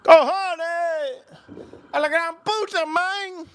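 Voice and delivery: very high exclamations in a put-on Spanish voice; hammed-up high Spanish outburst; high-pitched mock cursing. Mock Spanish cursing amid fireworks.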